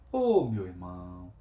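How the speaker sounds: soft, quiet, fatherly comfort; quiet moved father figure soothing a son; moved and tender. Retold or imitated. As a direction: console him gently, a touch moved. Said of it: "Falou comovido".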